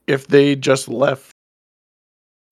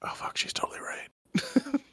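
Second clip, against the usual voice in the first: mock whisper; 12 semitones higher, 17 dB quieter than usual. Nynaeve realizes, voiced whispering.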